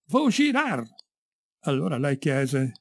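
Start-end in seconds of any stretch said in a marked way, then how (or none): 0.1-0.9 high conductor's cry; third shouted call. A narrator's reading. Conductor calling to the last passenger, shouted. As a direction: call out the stop high and long. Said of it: "L'uomo disse per la terza volta".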